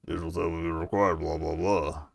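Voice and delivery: a lightly gruff captain impression; gruff mimic voice. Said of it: "So gruff".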